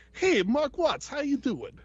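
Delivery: high Vinnie Barbarino voice, New York twang; a high Brooklyn wise-guy voice imitating the artist; Mark Watts impression. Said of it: "his voice is just amazing".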